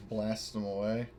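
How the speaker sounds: ordinary delivery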